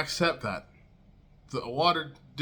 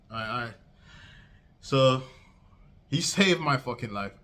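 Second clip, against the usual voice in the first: usual speaking voice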